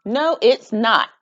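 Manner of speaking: loud, slow stubborn no; shouted. Acted. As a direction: dig in and shout it. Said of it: "shouted Ruthie".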